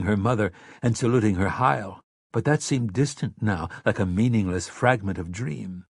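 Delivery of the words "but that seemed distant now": ordinary delivery, nothing marked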